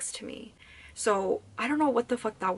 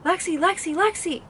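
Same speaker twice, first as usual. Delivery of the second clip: high, loud name called from outside; imitating a sing-song shout of her name from outside the house; imitating brother calling; 9 semitones higher, 5 dB louder than usual. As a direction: call the name like a brother yelling to hurry her up. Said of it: "that exact way that he said my name".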